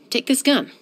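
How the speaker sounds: ordinary talk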